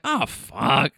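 lightly imitated old grump's groused curse; a touch of grumpy old man; grumpy old guy. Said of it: "this grumpy old guy's like".

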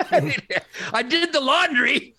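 high, drawn-out laughing delivery; real amused laugh in the words. Real, not acted. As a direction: laugh through the punchline. Jokes about his chores.

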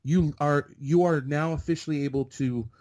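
high, punchy imitation of an incredulous extra; emphatic disbelief. Envious extra telling newcomer what he landed, emphatic disbelief. Retold extra spelling out his luck.

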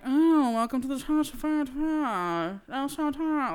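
mock snobby Australian saleslady, words running together; imitating Kath and Kim. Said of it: "they talk like this".

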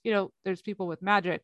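ordinary delivery

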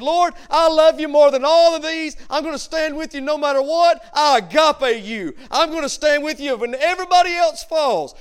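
loud, high, boastful vow; prideful, arrogant. Retold or imitated. Peter vowing to the Lord he will stand, prideful and boastful. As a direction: proclaim devotion with arrogant force. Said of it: "He was prideful and he was arrogant".

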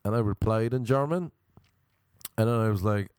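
ordinary talk

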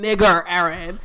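mock yell of a stranger on the stairs; retold scream. Retelling a man screaming in Penn Station, mock yell. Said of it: "starts screaming".

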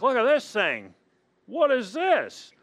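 put-on high, loud, curious child's voice; playing a kid who found something odd in the sandbox, high and wondering; kid's surprise. A preacher voices his child self in the sandbox, high and surprised. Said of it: "You're like".

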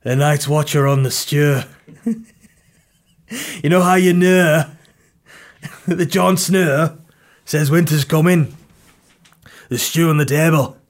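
put-on accent of a fantasy Night's Watch man; Game of Thrones voice. Comedian riffs on the Night's Watch eating stew, mock Game of Thrones voice. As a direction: proclaim it as a hammed-up Game of Thrones character. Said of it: "Why do I speak like that?".

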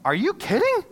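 high, loud exclamation of disbelief; disbelieving out loud. Retold or imitated. Pastor alone in his office after the call, exclaiming in high disbelief. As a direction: blurt it out, stunned by the news. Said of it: "Like, I said it out loud".

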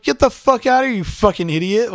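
high, loud, angry order; furious retold shout. Retelling telling the attacker to leave, angry shouting. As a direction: yell it as if throwing someone out. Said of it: "I was so fucking mad".

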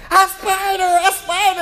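high, loud mock shriek of a scared child; hammed-up panic at a creepy-crawly; Cammie. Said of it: "even Cammie's like".

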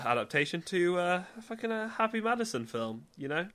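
ordinary talk

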